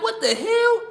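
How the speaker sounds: can't believe what he's hearing; a high, stunned outburst of disbelief. Retold or imitated. Shocked to be on news.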